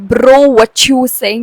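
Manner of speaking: loud, exclaimed incredulous question; retold disbelief. Teller reacts to friend's confession, loud retold disbelief. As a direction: burst out in acted disbelief.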